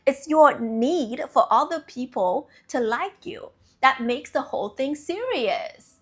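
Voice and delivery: normal talking voice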